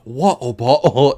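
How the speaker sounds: slow, drawn-out mock English; badly faked English vowels, stretched out; bad English accent. Actor answering the casting room, slow bad English accent. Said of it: "people with really bad English accents".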